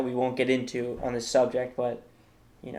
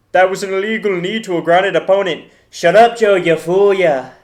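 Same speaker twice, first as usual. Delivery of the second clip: shouted, much higher Irish rant; Conor McGregor impression; 6 semitones higher, 12 dB louder than usual. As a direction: yell it like an outraged Irish fighter in a voice memo. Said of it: "here's my impression of my favorite voice memo".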